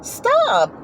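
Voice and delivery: mock squeal at a playful horse; hammed-up high-pitched protest. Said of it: "I'm like".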